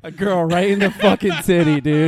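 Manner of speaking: loud, high laughing shout; alarm shout. Real, not acted. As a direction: blurt it out in shocked delight. Lime hits girl, laughing outburst.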